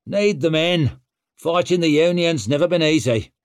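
put-on loud, cocky young war leader; brash young warrior. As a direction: swagger through it. Stour's voice, brash young warlord.

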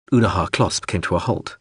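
usual speaking voice

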